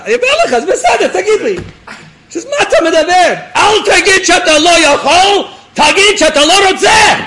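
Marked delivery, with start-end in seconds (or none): 0.0-1.6 high, fast, loud insistence; loud urging. Acted. Rabbi pressing the officer to tell him, loud urging. 2.5-7.2 very high, loud, fast yelling; confrontational shout. Acted. Rabbi confronting the officer over his refusal, confrontational shout.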